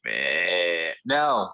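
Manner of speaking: low groaning zombie grunt; zombie noise. Pretend. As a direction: grunt it out like the undead. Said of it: "your best zombie noise?".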